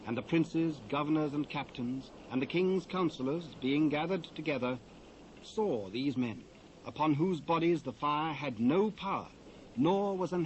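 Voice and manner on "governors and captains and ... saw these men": ordinary delivery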